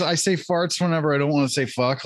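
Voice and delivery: ordinary talk, nothing marked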